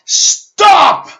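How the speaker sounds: sharp, high, loud yell of Stop; shouted stop. Shown as a demonstration. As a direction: break the thought loop with a loud cry. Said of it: "just go like this".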